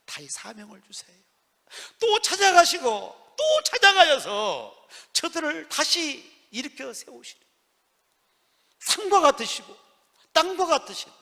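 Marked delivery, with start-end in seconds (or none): 2.0-11.1 high, drawn-out emphatic proclamation; fervent preaching. Acted. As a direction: stretch the key words and lift the voice. Preaching at full force. (길게 늘여 힘주어 외치는 설교)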